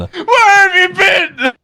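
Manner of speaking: slow, high, sobbing wail; crying shout. Acted. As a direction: wail it out, hammed-up grief. Dramatic friend crying out.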